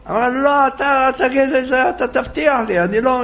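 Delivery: high demanding old woman, mock yell. A narrator's reading. Old woman demands the rabbi's promise, high mock yell. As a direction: press him for the promise, pitched high. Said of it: "היא צועקת".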